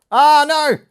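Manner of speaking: loud high cry of dismay; dismayed shout. Real, not acted. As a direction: shout as it tears. Resealer tears the packet.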